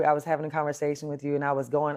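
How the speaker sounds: ordinary delivery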